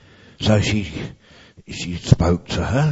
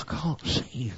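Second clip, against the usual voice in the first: close to tears; 4 semitones higher, 12 dB quieter than usual. A narrator's reading. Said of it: "And she began to cry".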